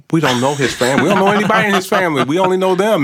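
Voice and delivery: building to a loud, high, fast protest; rising high, loud exasperated objection. Retold or imitated. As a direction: insist harder each line, racing. Retold refusal, rising insistence.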